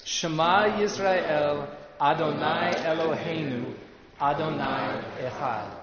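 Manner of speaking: slow, measured Hebrew chant-like recitation, firm at the start; leading group recitation. Shown as a demonstration. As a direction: lead the room phrase by phrase, slowly. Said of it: "So let's say it together".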